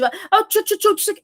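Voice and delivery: high, cross dog scolding; mock scolding. Shown as a demonstration. Trainer showing how a pet gets scared, mock angry scolding. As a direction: scold the puppy with the same silly syllables. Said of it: "mas de forma brava".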